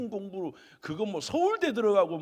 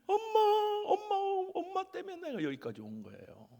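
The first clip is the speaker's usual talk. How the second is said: put-on crying child; 11 semitones higher than usual. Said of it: "막 우는 거예요".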